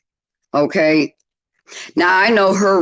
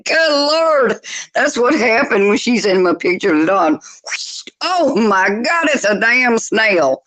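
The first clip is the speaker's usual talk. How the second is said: higher, slow, laughing through it; laughing disbelief; 5 semitones higher than usual. Retold or imitated. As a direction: crack up at the snail. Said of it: "I said".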